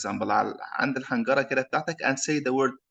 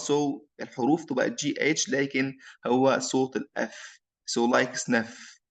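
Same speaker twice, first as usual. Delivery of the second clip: ordinary talk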